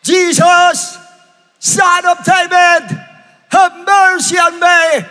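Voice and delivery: high, loud, drawn-out cry. Acted. Bartimeus begs Jesus despite the crowd, shouting. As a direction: holler each phrase at full force. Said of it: "lalong sumigaw".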